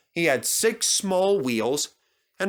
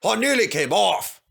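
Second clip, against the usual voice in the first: high and louder, indignant; fuming complaint; 7 semitones higher, 4 dB louder than usual. Acted. Duncan storming up to the engines, fuming high complaint. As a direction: vent about the near accident. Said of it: "fumed Duncan".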